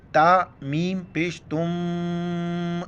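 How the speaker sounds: تم with the mim hummed through the nose, slow; nasal ghunna. Shown as a demonstration. Teacher shows ikhfa on تم, nasal hum. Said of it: "ناک میں آواز چھپائیں گے".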